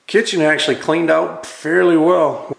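ordinary talk

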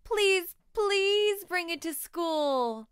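put-on high, loud, pleading child; a girl begs her friend to bring the dragon; begging. A narrator's reading. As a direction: beg like you really want it. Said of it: "begs Willa".

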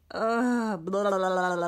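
loud hammed-up gagging blah noises; mock retching. Shown as a demonstration. Teacher playing the sick character for kids, mock retching. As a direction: pretend to throw up for laughs. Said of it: "feeling a bit sick".

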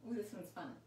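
ordinary talk, nothing marked

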